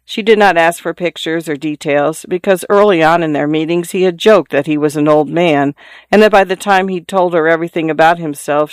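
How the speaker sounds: usual speaking voice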